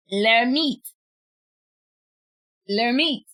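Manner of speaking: Lermite said the French way, stress on the last syllable mite; French attempt. Shown as a demonstration. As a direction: sound it out in careful French, Lermite as lair-MEET. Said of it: "I'm trying to say it properly".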